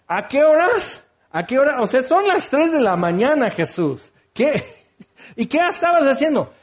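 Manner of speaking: high, incredulous, laughing complaint; mock whiny disciple. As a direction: whine at him like an annoyed disciple.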